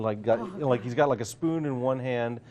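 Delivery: ordinary delivery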